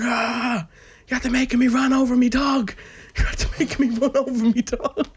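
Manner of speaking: imitating a farmer shouting while laughing. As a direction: yell in panic at the caller. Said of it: "your man starts shouting".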